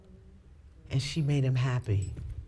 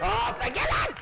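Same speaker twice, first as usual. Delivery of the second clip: much higher, squeaky mock plea; hammed-up high whine; mock exasperated plea; 3 dB louder than usual. Mocking herself, high voice.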